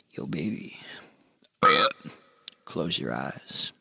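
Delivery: very low, soft, slow murmur; hushed come-on. Retold or imitated. Man creeping up on client, hushed come-on. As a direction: murmur it like a mock seducer.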